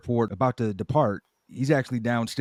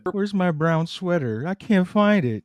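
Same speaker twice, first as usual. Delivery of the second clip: higher-pitched acted griping; nagging complaint; 7 semitones higher than usual. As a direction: play a partner griping while hunting for something. Acting out a couple's quarrel.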